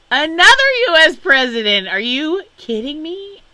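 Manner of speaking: very high, loud, fast disbelief; exasperated shriek. Real, not acted. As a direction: shriek it, fed up and amazed. Exasperated at yet another president.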